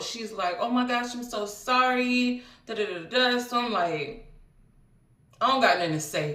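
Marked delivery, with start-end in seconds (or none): 0.6-3.4 imitating her freaking out. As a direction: retell her panic, pitched up. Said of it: "she's freaking out".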